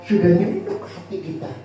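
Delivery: ordinary talk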